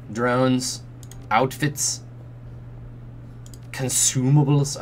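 usual speaking voice

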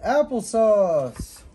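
loud, slow cheeky shout; drawn-out loud shout of applesauce; shouted answer. A narrator's reading. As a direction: blurt it out loudly to be funny. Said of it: "Timothy shouted".

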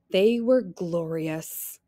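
usual speaking voice

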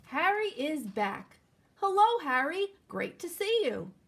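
put-on slow, lively storybook reading for kids; reading to children. A narrator's reading. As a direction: read it out like story time for little ones. Reading picture book to children.

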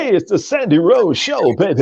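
high, swooping announcer hype; put-on radio voice. Mocking an on-air intro he won't do, put-on radio voice. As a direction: ham up a radio show intro. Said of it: "you don't want to say".

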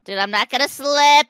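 usual speaking voice

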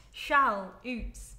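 Shall-oots in two parts, shall then oots; split into syllables. Shown as a demonstration. Vlogger sounding out the word for viewers, syllable by syllable. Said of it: "There's no way you say shaloots".

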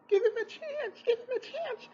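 high, whiny mocking plea; mock whiny fan. Reviewer mocks fans begging for patience, high whiny voice.

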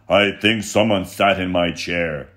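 loud booming bear voice; thundering Papa Bear. Acted. Papa Bear finding his chair used, loud thundering voice. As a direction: thunder it out like a huge angry bear. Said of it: "Then he thundered".

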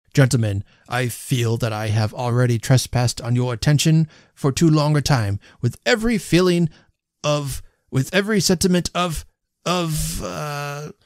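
pompous toast stalling on "of"; faltering speechmaker. A narrator's reading. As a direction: grope for the missing word. Host falters in his toast.

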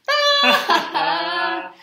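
big sung-out pretend laugh. Storyteller laughs on cue at the silly rhyme. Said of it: "laugh like it is the silliest".

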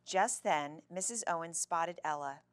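usual speaking voice

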